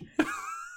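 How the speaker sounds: breathless high-pitched laugh; high squealing laugh. Real, not acted. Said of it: "I'm glad you're getting enjoyment".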